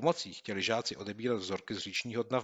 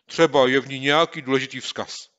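louder, higher, urgent; eager boy; 5 semitones higher, 13 dB louder than usual. Acted. Boy urging his friend to swim, eager. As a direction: press on with eagerness.

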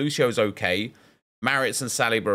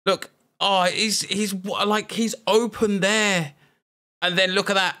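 high-pitched disbelief, slowing on "he's open there"; higher, slower, stunned at the missed chance; incredulous replay; 9 semitones higher than usual. Real, not acted. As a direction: point at the replay in pure disbelief.

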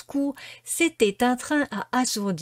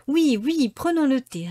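higher, louder eager chorus; excited girls; 6 semitones higher, 4 dB louder than usual. A narrator's reading. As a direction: cheer it together like children. Girls answer together.

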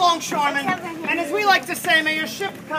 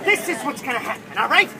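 normal talking voice

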